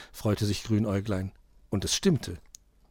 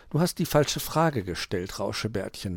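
ordinary delivery, nothing marked

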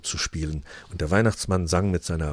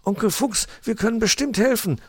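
put-on much higher eager child voice; young nephew; 14 semitones higher than usual. Nephew Weißohr offering help to his uncle, high and eager. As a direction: pipe up helpfully like a little kid.

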